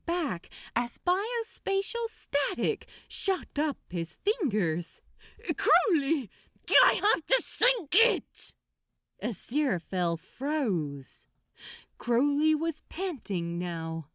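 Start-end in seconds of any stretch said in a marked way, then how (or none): 5.5-8.5 much higher, loud anguished cry; a demon screaming his awful task; desperate outburst. Acted. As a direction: force the confession out in agony.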